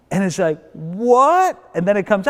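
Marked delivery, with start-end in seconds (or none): 0.7-1.6 acted rising yelp of disbelief; incredulous high question; shocked. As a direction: play it stunned, pitch shooting up. King voiced reacting to the news.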